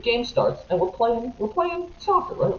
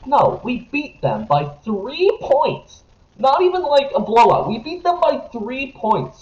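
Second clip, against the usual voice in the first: exasperated outrage; 8 semitones higher than usual. Real, not acted. Complaining to his co-host about the Jets winning, exasperated. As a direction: stretch it out in angry disbelief.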